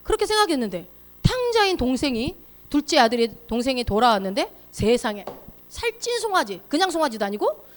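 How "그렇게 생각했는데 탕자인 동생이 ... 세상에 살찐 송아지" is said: normal talking voice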